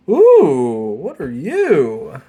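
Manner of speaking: loud ooh, slow swooping question; stretched, swooping "what are you"; intrigued delight. Real, not acted. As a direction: coo with drawn-out curiosity at the find. Intrigued by a new card.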